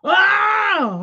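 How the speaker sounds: high, loud wailing "아!"; imitated infant wail; mock baby cry.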